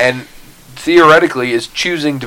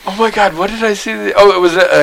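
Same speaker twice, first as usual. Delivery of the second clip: excited; 9 semitones higher than usual. Real, not acted. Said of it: "excited recall".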